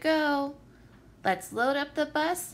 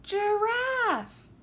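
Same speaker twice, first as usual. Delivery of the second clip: excited reveal; 8 semitones higher than usual. A narrator's reading. Answer announced after drawn-out pause.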